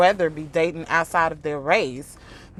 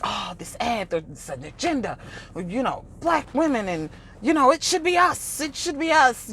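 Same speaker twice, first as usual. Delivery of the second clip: whiny mock voice, rising much higher; hammed-up imitation of him; mocking his complaint; 7 semitones higher than usual. Mimicking Godfrey complaining about the ad, whiny.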